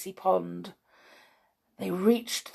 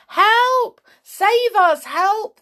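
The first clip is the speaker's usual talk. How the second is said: high, loud cries for rescue; panicked, high-pitched shouting; frightened yell; 9 dB louder, slower than usual. A narrator's reading. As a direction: scream for help as if sinking. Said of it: "they yelled in fright".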